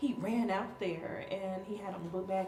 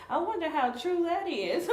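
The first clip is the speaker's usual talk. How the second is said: put-on higher, louder pondering child voice; musing child; 9 semitones higher, 6 dB louder than usual. Four-year-old pausing to question the speech, higher voiced. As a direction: wonder aloud like a curious kid. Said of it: "she would just stop and be like".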